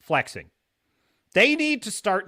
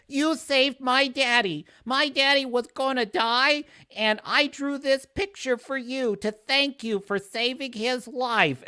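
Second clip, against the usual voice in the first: put-on little girl voice; 10 semitones higher than usual. Child thanking an actor in hospital, high and sweet. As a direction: play the grateful little kid.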